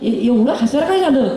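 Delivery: ordinary talk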